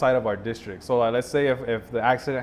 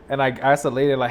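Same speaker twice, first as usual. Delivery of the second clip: usual speaking voice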